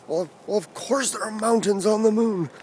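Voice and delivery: high put-on voice of a grumbling dad; grumbling sleepy dad. Sleepy dad answering his child through the door, mock grumbling. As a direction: imitate a roused dad grumbling back. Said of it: "dad's sleepy voice within grumbling".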